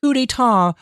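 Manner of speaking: very high imitation of a woman; mimicking woman's voice. Streamer mimicking pronunciation clip, high woman's voice.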